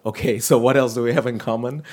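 playful, laughing self-question; amused wondering. Retold or imitated. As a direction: laugh through a puzzled thought. Retelling amused thought about stranger.

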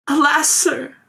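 put-on high, tearful young woman's plea; sobbing. A narrator's reading. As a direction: plead through light sobs. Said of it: "sobbed the girl".

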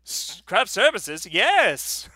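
slow, lightly voiced Matt Berry-style drawl on Oliver Platt; a hint of Matt Berry's pompous delivery. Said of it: "I just put his voice in Oliver Platt".

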